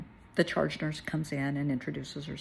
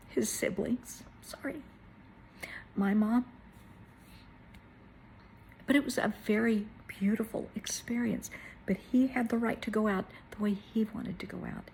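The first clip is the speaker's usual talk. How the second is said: higher voice, breaking into near whisper; choked up, halting and tearful; holding back tears; 4 semitones higher than usual. Real, not acted. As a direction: keep talking through real tears. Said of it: "I'm trying not to cry here".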